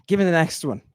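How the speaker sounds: ordinary talk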